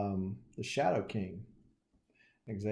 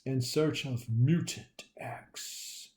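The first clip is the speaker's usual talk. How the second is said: slow, breathy mysterious whisper of a title; dramatic whisper; 3 semitones higher, slower than usual. Acted. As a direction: whisper the title like a movie trailer. Dramatic comic title reading.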